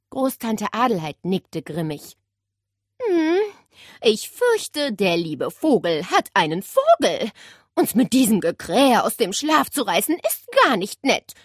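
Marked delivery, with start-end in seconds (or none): 3.0-11.3 put-on higher, louder dry old-lady voice; grim great-aunt. Great-aunt complaining about the parrot, grim dry voice. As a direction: grumble it like a grumpy old aunt. Aunt's voice.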